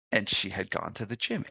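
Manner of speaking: ordinary talk, nothing marked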